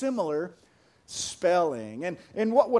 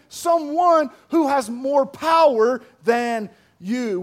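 loud, raised-pitch sermon delivery; impassioned preaching; 5 semitones higher, 6 dB louder than usual. Real, not acted. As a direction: preach it forcefully, stretching key words. Preaches at full force.

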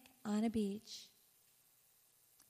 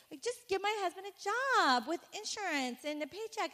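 pleading to God; 10 semitones higher than usual. Retold or imitated. Retold pleading prayer.

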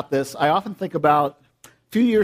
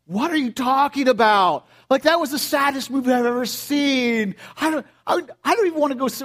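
incredulous friend; 7 semitones higher than usual. Retold or imitated. Friends react to Marley and Me.

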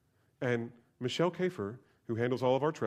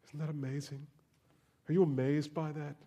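normal talking voice

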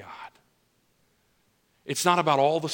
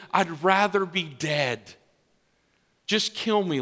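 higher, pleading prophet at the end of his rope; exhausted Elijah; 6 semitones higher than usual. Acted. Elijah complaining to God on the mountain, higher and pleading. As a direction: whine to God that you've done it all alone. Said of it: "And Elijah says".

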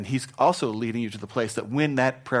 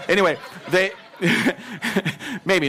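laughing mid-sentence, higher; 8 semitones higher, 5 dB louder than usual. Real, not acted. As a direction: break into a real laugh. Laughing breaks through.

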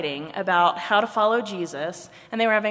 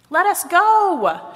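high, loud, drawn-out protest; shouted; 10 semitones higher, 9 dB louder, slower than usual. A narrator's reading. Legs are grabbed by Arms, shouting. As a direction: shout as if struggling to get free. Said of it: "shouted Legs".

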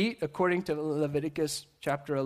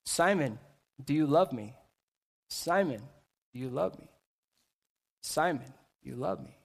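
soft, lower voice with long pauses between phrases; gentle questioning; 5 semitones lower, 5 dB quieter than usual. Retold or imitated. Jesus questioning Peter three times on the beach, gentle and hushed. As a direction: ask it low and softly, letting each phrase hang.

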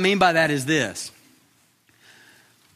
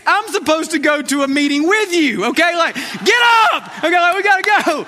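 loud, much higher exasperated yelling; unkind dad voice; 10 semitones higher, 4 dB louder than usual. Retold or imitated. Dad yelling at kids running late for school, much higher and loud. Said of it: "I'm like".

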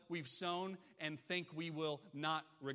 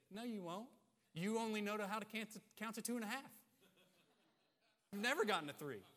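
put-on defiant kid; 4 semitones higher, faster than usual. Kid silently answering mom's count to three, lightly mocking. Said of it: "in their minds they say".

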